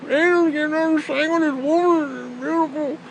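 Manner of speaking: high, goofy enthusiastic voice; imitating his friend. Friend raving about the angels, high goofy voice. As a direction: ham up the starstruck friend. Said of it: "D L T is like".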